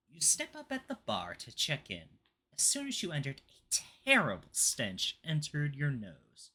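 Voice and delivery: dramatic spooky reading; story narration. Acted. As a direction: read it like a creepy tale. Reads the next note aloud.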